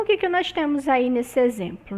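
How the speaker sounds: ordinary talk, nothing marked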